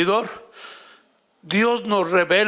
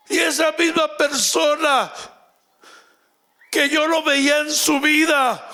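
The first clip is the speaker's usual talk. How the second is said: raised, urgent preaching voice; fervent testimony; 5 semitones higher than usual. Acted. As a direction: preach it out with rising fervour.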